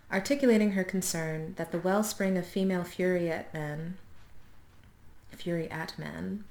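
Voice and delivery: ordinary talk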